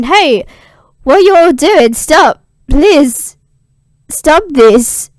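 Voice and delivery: high, loud cry to stop them; pleading shout. Retold or imitated. Ascanius stops the burning women.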